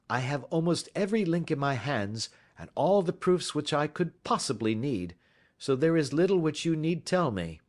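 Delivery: polite detective taking charge, lightly voiced; lightly voiced Holmes. A narrator's reading. Holmes speaks.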